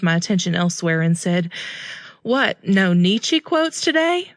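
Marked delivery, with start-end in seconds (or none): 2.2-4.3 teasing. A narrator's reading. Narrator teasing Drew.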